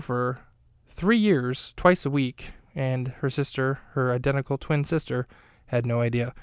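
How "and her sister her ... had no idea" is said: ordinary talk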